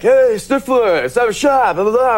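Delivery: hammed-up party bro hailing Stifler; frat guy. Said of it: "the frat guys that came up to me and they're like".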